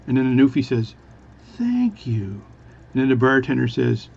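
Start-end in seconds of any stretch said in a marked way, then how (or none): 1.6-2.4 high, drawn-out syllables; really slow speech. Acted. Newfie thanking the bartender, really slowly. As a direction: stretch both words, exaggeratedly. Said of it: "is speak really slowly".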